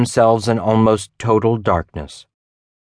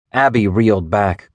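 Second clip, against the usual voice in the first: plain reading voice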